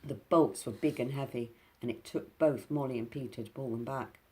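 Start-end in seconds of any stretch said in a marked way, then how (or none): none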